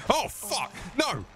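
high, startled yelp at a mistake; panicked yelp. Real, not acted. Gamer caught off guard by an enemy, yelping in high panic. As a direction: squeal it out in sudden panic.

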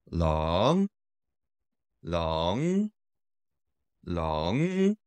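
long LONG rising like a question; rising second tone. Shown as a demonstration. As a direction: draw out LONG and let it climb. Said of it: "Dragon is rising tone".

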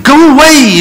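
loud, high command; a believer yelling at his storm; mock rebuke. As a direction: shout at the storm.

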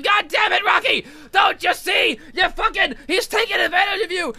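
high-pitched imitated scolding yell; Adrian yelling. Adrian warns Rocky he's being used, yelling. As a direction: scold him as an exasperated wife. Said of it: "Adrian's yelling at him".

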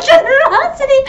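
very high squealed GENEROSITY; high, self-mocking squeal; laughing mock repeat. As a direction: repeat the word through giggles. Mocks own word, laughing.

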